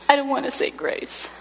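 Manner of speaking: put-on a sobbing toddler's small protest; lightly sobbing child voice; tearful child. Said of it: "just sobs".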